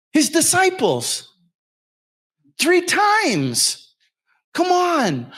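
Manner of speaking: revival-style sermon cadence; preaching. Acted. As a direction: preach it out at full force. Preaching about God working through people.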